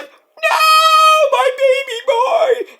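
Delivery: very high, drawn-out mock wail; wailing mom. Mom watching her son get hit, wailing mom. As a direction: cry it out slowly in a shrieking falsetto. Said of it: "Mom's like".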